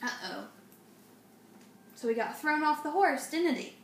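usual speaking voice

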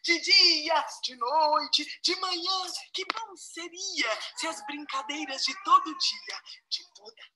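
poem recital. Acted.

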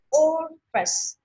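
"Office" slow, OF high and held; first-syllable stress. Shown as a demonstration. Teacher repeating for the class, high first syllable of "Office". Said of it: "Nó nhấn âm đầu".

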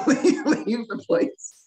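words squeezed out through a laugh. Real, not acted. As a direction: laugh while you say it. Laughing through the end of the story.

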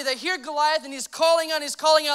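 ordinary talk, nothing marked

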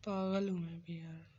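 ordinary delivery

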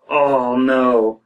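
ordinary talk, nothing marked

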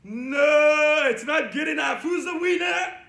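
loud, playful contest challenge; mock protest. Host judges mommy's sheep voice, loud mock protest. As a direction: shout down the rival sheep playfully.

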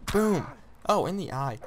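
loud, high burst of triumph; excited shout. Real, not acted. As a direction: shout it as the hit lands. Landing a hit in game.

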